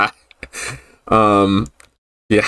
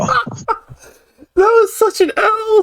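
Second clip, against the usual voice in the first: laughing; much slower than usual. Real, not acted. Laughing at own embarrassment.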